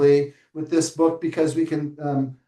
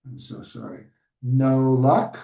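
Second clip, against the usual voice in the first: ordinary talk, nothing marked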